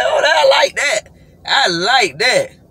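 high, loud, slow savoring; delighted. Real, not acted. Reacts to the song he just sang. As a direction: relish it. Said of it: "I'm like".

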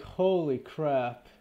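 ordinary delivery

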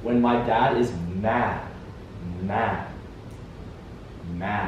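slow and soft, "Mad" set apart on its own; isolating MAD. Shown as a demonstration. Correcting a friend who guessed back, "Mad" said alone and softly. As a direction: repeat "Mad" alone after a pause, quietly.

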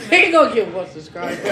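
laughing loudly while mocking; teasing laugh at his cousin. Real, not acted. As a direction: crack up mid-line. Laughing at friend.

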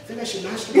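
very soft, timid question; tiny hushed voice, acted; quiet like a kid. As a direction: imitate a sheepish kid asking under the breath. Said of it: "he gets real quiet like a kid".

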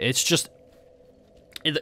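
ordinary talk, nothing marked

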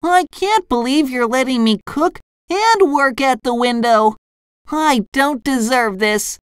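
high, gushing cartoon voice; SpongeBob. A narrator's reading. Fry cook given window job, gushing exclamation. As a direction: marvel squeakily, overcome with gratitude. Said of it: "SpongeBob exclaimed".

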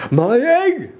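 high, excited shout; shouted high, stretched exclamation; Horton. A narrator's reading. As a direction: cry out in amazement. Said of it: "shouted Horton".

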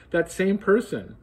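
mild alarm as he answers; trace of alarm. Acted. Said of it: "replied with a general trace of alarm".

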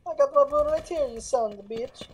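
mock Italian accent. Luigi answering Mario back, in a mock Italian accent. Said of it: "Talk in the Italian accent".

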